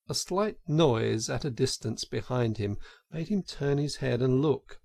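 ordinary delivery

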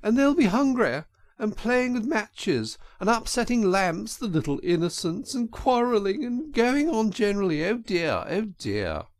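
high, piteous put-on lament; sobbing Toad as washerwoman. A narrator's reading. Toad frets about the little innocents, sobbing high. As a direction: keep wailing in a high mock-tearful voice. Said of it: "sobbed Toad".

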